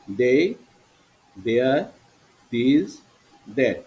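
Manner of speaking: teacher sounding out single words; pronunciation demo. Shown as a demonstration. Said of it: "প্রনাউন্সিয়েশনগুলো বলি". (উচ্চারণ শেখানোর ভঙ্গিতে আলাদা আলাদা শব্দ)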